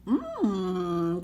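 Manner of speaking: usual speaking voice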